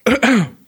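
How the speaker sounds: sharp cough after choking on cocoa; coughing throat clear. Real, not acted. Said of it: "oh Gott, verschluckt".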